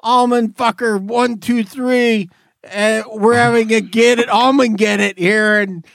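high, hammed-up tourist bragging; mock excited caller. Caller gushing about almonds in a hammed-up voice. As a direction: gush like a goofy caller.

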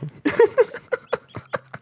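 a high, odd squealing laugh; strange new laugh. Real, not acted. Friend cracks up at a misspelled word, high laugh. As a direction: break into a weird high laugh. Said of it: "That's a new laugh".

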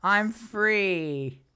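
mock celebration, high and drawn-out cheer; Orion. Newly divorced man celebrating his freedom, mock-gleeful. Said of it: "He's like".

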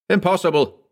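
high-pitched exclamation; indignant denial. A narrator's reading. Lawyer rejects the cat in the chimney, indignant denial. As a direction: dismiss the idea emphatically.

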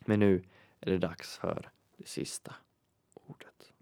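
drops to a dramatic whisper on the last word; hushed suspense. Pretend. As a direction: quiz host teasing the secret word.

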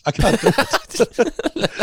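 slow, high, laughing; giggling surrender. Real, not acted. Host failing the impression, slow laughing give-up. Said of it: "gives up laughing".

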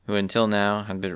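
ordinary delivery, nothing marked

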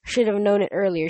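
plain reading voice

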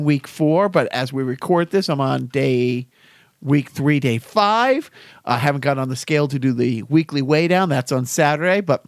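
ordinary talk

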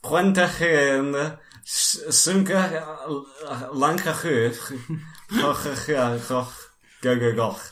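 slow, throaty fake Welsh. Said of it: "can you say for me in Welsh".

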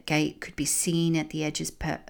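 ordinary delivery, nothing marked

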